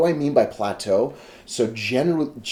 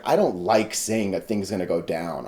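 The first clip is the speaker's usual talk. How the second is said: normal talking voice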